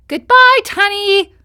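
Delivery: put-on high, loud boy puppet voice; high, loud Pinocchio voice; indignant puppet. A narrator's reading. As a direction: insist you are no fish.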